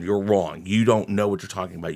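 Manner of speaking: ordinary talk, nothing marked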